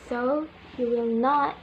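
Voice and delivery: ordinary talk, nothing marked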